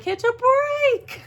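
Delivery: high, slow, whiny lament; mock wail. Complaining about her bad luck.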